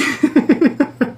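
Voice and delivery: a sudden high giggle; high burst of laughter. Real, not acted. Laughing at being fooled by the dream, a high giggle. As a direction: laugh high at how odd it was.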